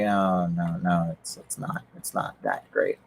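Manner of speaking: muttered self-correction. Retold or imitated. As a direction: talk yourself down quietly, fading away. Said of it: "and I'm like".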